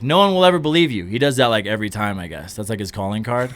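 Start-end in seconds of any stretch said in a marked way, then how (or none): 0.0-1.0 louder, higher teasing "no one will ever believe you"; Bill Murray teasing a stranger, louder and higher; mock Bill Murray line. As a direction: tease a stranger like a mischievous celebrity. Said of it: "he'll be like".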